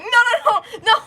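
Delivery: high, loud, rapid no's in a flustered rush; panicked denial. Retold or imitated. Luna taking back what she said to Gemini, high panicked no's. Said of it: "And she's like".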